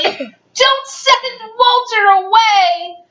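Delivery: high, slow wailing plea; hammed-up drawn-out begging. A narrator's reading. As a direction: plead like an upset child. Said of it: "begged Betty and Billy".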